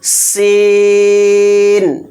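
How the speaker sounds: long held sīn, slow and even; drawn-out letter name. Shown as a demonstration. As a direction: stretch sīn out for the class to copy. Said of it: "اس کا sound بالکل باریک سیٹی کے ساتھ ہے". (سین کو لمبا کھینچ کر پڑھیں)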